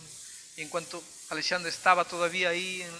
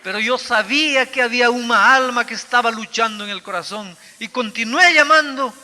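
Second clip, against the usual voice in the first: rising to a full-force, much louder preaching cry; fervent preaching; 6 semitones higher, 16 dB louder than usual. Acted. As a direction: preach it out with mounting fire. (predicación ferviente, cada vez más fuerte)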